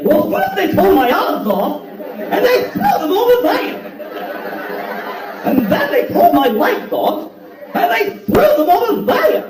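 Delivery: high, slow, hammed-up wail; wildly overacted lament, pitch soaring at the ends; hammed-up Scarecrow whining about his lost limbs; over-the-top Scarecrow. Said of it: "performance that's way over the top".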